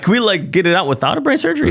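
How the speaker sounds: high-pitched, incredulous pleading question; retold plea. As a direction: act out a squeaky hopeful plea.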